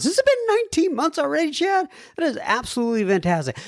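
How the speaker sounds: high, fast and amazed. Real, not acted. Host amazed at caller's nineteen months. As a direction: marvel at how long it has been.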